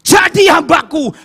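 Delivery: slow, loud divine call from a dream; booming voice of God. Retold or imitated. Preacher retelling God's call in a dream, booming. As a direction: boom it out as the Lord calling his servant. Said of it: "Suara begitu keras".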